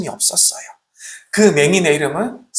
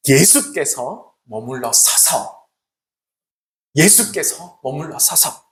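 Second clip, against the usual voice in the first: slow emphasis; 3 semitones lower, 3 dB quieter, slower than usual. Shown as a demonstration. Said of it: "이렇게 말씀하고 있습니다".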